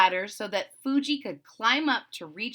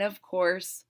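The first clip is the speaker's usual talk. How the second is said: ordinary delivery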